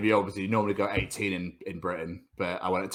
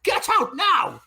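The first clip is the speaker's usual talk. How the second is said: high, louder mock shout; mock teacher shout; 6 dB louder, slower than usual. Teacher ordering Tom out again, high mock shout. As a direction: screech the order like a cross teacher. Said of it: "teacher yelling pupil out".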